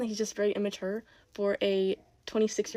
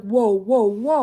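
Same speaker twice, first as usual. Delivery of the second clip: loud mock gasp of alarm, cut in fast; imitating viewers' shock; 8 dB louder, much slower than usual. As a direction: play the shocked audience, half-joking. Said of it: "Before you go, like".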